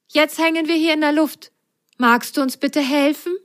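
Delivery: a small sock asking nicely for help; polite little sock. Acted. Ise apologizes to the snake and asks for help, high and polite.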